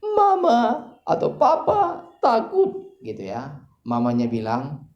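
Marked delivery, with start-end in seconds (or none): 0.0-3.0 put-on high, drawn-out wail of a frightened child; crying child. Small child waking in a blackout, crying out. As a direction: imitate a small child wailing for a parent.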